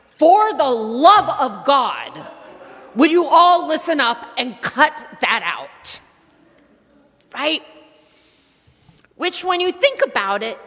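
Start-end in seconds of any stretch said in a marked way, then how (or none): 0.0-6.0 exasperated scolding. Retold or imitated. Preacher voicing John the Baptist scolding the crowd, exasperated. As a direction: scold them like a fed-up prophet. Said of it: "to John going".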